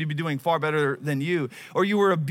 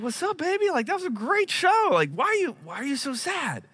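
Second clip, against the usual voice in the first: tender comforting dad; 10 semitones higher than usual. Retold or imitated. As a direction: coax her like a worried father. Dad comforting crying daughter backstage.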